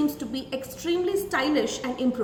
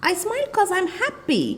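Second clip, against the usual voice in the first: higher, light casual sentence; casual contraction; 8 semitones higher, 3 dB louder than usual. Shown as a demonstration. Teacher showing children the contracted casual sentence, higher. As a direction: toss it off lightly. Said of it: "it becomes".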